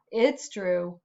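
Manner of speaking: ordinary delivery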